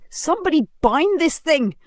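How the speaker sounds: high panicky shouting; frightened shout. Acted. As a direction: warn the crowd in panic. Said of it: "One woman shouted".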